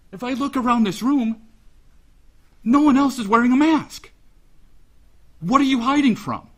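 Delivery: loud, high, shaking with anger; real fury poured at him across the courtroom; angry outburst. Real, not acted. Father shouting at his children's attacker in court, furious.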